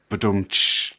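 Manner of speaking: spoken ba-dum-tish drum sting; imitated rimshot. Shown as a demonstration. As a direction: mouth a comedy drum sting.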